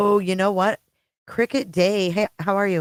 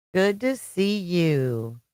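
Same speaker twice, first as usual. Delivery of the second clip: slow drawn-out greeting; much slower than usual. Real, not acted. Greeting repeated slowly.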